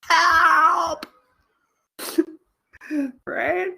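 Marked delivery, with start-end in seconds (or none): 0.0-1.1 high, loud comic yelp; drawn-out, high-pitched plea; mock cry for help. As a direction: cry out as the scared grocery boy. Voicing the scared grocery boy.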